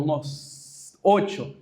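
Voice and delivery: usual speaking voice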